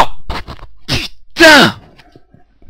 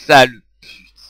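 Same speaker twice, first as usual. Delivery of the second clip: angry insult; 3 semitones lower, 11 dB louder than usual. Real, not acted. Insulting the game.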